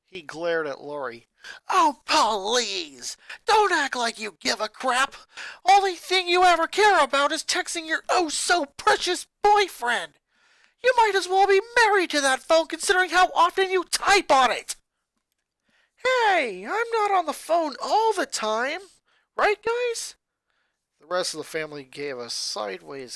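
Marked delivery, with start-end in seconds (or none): 1.7-14.8 put-on high, loud furious boy shouting at family; a boy exploding at his mother; angry boy ranting. Lincoln mocks Lori. 16.0-20.1 high, indignant protest; defensive older sister. Acted. Lori defensive.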